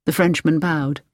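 ordinary delivery

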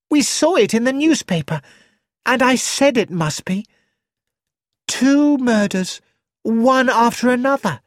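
put-on young actress, high and breathless, eager about the news; Rosamond. A narrator's reading. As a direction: chatter it out, high and a touch dramatic. Rosamond's excited chatter.

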